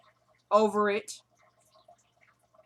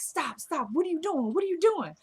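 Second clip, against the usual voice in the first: retold urgent protest; 7 semitones higher than usual. Retold man protests the fake moaning.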